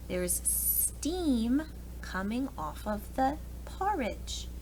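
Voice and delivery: usual speaking voice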